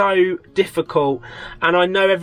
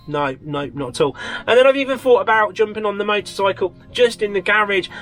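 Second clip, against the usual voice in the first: ordinary talk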